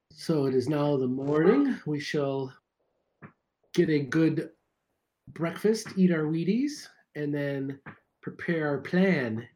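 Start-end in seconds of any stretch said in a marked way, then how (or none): none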